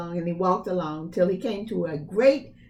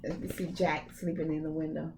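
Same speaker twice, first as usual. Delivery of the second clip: soft aside; 5 semitones lower, 8 dB quieter, faster than usual. Real, not acted. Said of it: "aside to children".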